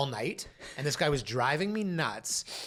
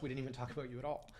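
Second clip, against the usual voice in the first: teasing quiet aside; 10 dB quieter, much faster than usual. Pretend.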